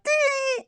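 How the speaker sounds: very high sung "Dee" sound; warm-up "Dee". Shown as a demonstration. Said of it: "like this".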